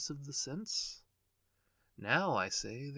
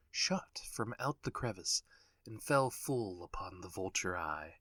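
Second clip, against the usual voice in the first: ordinary delivery, nothing marked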